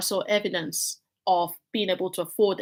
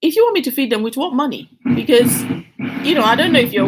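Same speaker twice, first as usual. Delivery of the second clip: exasperated plea to God; 4 semitones higher, 8 dB louder, faster than usual. Retold or imitated. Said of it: "I said".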